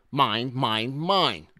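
loud, possessive roar rising in pitch. A narrator's reading. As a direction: roar it out to everyone, greedily. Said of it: "he roared to one and all".

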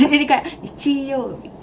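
normal talking voice

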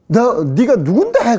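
acted scolding. Voicing the scolding question again.

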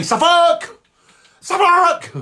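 "Suffolk" in a high, squawked shout; loud shout. Shown as a demonstration. Host showing how to shout it, "Suffolk" as a high shout. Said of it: "when you shout Suffolk loud".

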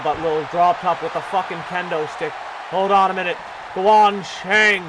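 ordinary delivery

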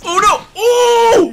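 high, loud "oh" of alarm; shocked outcry. Real, not acted. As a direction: cry out as your favourite takes a hit. Reacting to a hit on screen.

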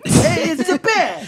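very high, slow Brooklyn goon; Brooklyn henchman accent. Acted. Henchman warning his gang, very high Brooklyn accent. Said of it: "In a Boston accent".

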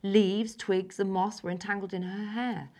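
ordinary delivery

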